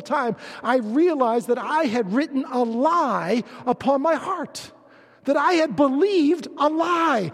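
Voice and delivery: loud, high, impassioned preaching; preaching at full force. Acted. Pastor testifying to his congregation, loud impassioned preaching. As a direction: preach it to the back row, full voice.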